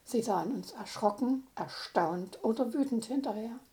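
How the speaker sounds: usual speaking voice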